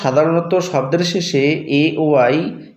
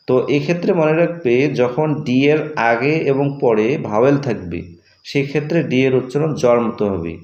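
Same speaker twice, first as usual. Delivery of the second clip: ordinary delivery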